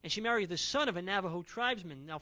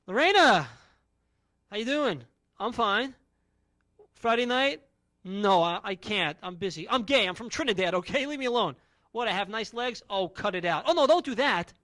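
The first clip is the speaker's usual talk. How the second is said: nervous brush-off; 7 dB louder than usual. Acted. Fending off Lorena at work.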